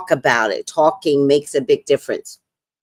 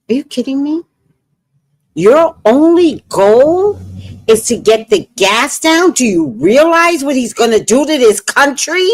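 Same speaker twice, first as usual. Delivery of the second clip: retold angry outburst, higher and louder; heated rant, higher and louder; 9 semitones higher, 6 dB louder than usual. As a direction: let the old frustration boil over. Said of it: "I got mad at him".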